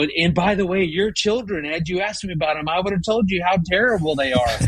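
high, quick, sarcastic comeback; indignant inner rant. Retold or imitated. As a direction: rattle it off as a fuming aside. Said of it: "just going".

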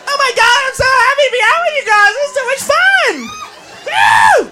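high, giddy squeal ending in a whoop; gushing party girl. Acted. Girl out with her friends on ladies' night, giddy gushing. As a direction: gush to the girls, high and thrilled. Girls thrilled to be out.

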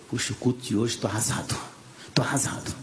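low, soft voice of a beaten man; deflated confiding. Retold or imitated. Preacher retelling his complaint to his wife after service, deflated confiding. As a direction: imitate confiding defeat, nearly muttered. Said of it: "E eu comento com a minha esposa".

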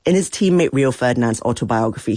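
ordinary delivery

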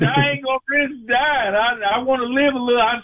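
high-pitched, animated protest; amused protest. Real, not acted. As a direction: back out in a high, half-laughing voice. Caller jokingly protests risk of dying.

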